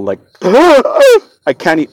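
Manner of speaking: sharp high gasp, then trailing off; a loud gulping gasp as you try to copy the noise; imitating a gurgling breath with one gasp; gasping try at the sound. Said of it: "I can't even make the sound that it made".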